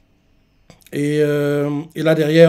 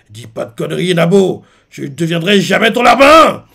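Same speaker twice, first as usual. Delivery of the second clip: louder, rising mock defiance; angry Loki; 3 semitones higher, 7 dB louder than usual. Loki refuses Luffy, a hammed-up angry retort. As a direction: spit it back like a proud prisoner. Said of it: "pas content, il fait".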